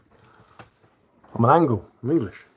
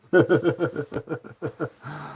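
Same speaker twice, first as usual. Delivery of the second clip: long, high-pitched chuckling; real laugh; 4 semitones higher than usual. Real, not acted. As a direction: laugh helplessly at the silly joke. Laughs at angle joke.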